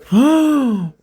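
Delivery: loud high-pitched gasp; big audible intake of shock. Real, not acted. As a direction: gasp sharply at the price. Shocked at the book's price.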